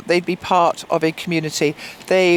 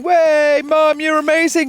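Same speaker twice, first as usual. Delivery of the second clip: cheering daughter; 8 semitones higher, 4 dB louder than usual. Retold or imitated. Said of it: "got Jemima going".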